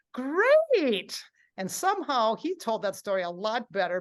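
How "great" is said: high, loud, drawn-out "great"; beaming great. Retold or imitated. Robert finally answering positively, beaming and enthusiastic. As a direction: belt it out through a big smile. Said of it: "smiles, he goes".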